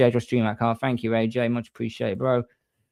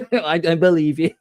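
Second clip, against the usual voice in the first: high, giggly laughing speech; 6 semitones higher, 4 dB louder than usual. Real, not acted. Streamer amused by his own kilt story, laughing. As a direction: crack up while getting the words out.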